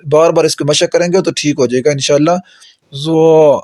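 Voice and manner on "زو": زو said soft without whistle; teacher modelling a soft letter. Shown as a demonstration. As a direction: keep زو soft. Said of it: "نرم کر کے زو نہیں کہنا".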